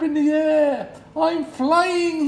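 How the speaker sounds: high, loud joyful duckling; thrilled little bird voice; excited cry. Acted. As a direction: shout it with delight as you take off. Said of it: "he cried excitedly".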